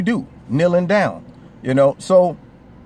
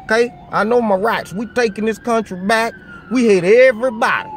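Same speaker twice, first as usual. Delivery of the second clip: mock hateful bigot; 8 semitones higher, 4 dB louder than usual. Parodying what YouTube thinks he says, hammed-up ranting bigot. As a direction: parody a loud hate-filled extremist. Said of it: "as if I'm on here like".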